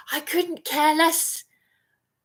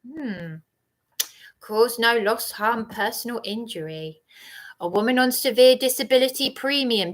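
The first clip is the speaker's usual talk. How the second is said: ordinary delivery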